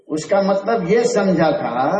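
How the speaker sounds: normal talking voice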